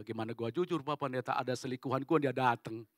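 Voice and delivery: low-voiced husband confiding a problem; reluctant husband. Husband confessing to pastor about his mistress, reluctant. Husband's worried reply acted out.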